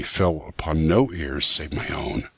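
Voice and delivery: ordinary delivery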